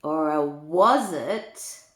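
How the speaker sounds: lilting storybook read-aloud; reading to children. A narrator's reading. Granny reading a rhyming book to children, lilting. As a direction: read the rhymes to little ones with relish.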